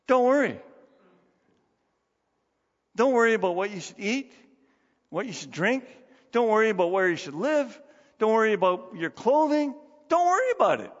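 rising into a high loud "Don't worry about it"; emphatic preaching. Shown as a demonstration. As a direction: proclaim "Don't worry" with full force.

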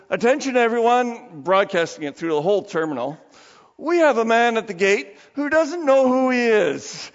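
loud, high public-address announcement; intercom announcement. Retold or imitated. Ticket clerk paging the terminal over the intercom, loud and sweet. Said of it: "speaks into it".

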